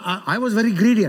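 normal talking voice

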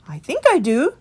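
meek small creature pleading for the way; Christopher. A narrator's reading. Christopher answers.

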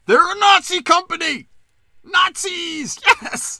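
high, loud mocking shriek of outrage; mock gleeful shout. Mocking the Instagram crowd with a high gleeful shout. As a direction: shriek it like a smug online scold.

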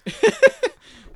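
a high, bursting laugh. Real, not acted. Big laugh at banter.

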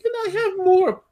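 put-on very high squeaky puppet-boy voice; Pinocchio. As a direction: plead in a tiny falsetto like a little wooden boy. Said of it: "you're like Pinocchio".

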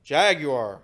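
"Jaguar." said jag-yoo-ar, loud and falling; "Jaguar." spoken jag-yoo-ar with a firm drop; British Jaguar. Shown as a demonstration. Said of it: "Mister Pronunciation Police".

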